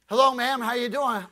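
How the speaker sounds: loud, cheery hello through the door; calling out. Retold or imitated. Preacher calling through the screen door, loud and cheery. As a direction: hail her warmly from the porch. Knocking, calling to woman inside.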